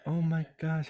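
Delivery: normal talking voice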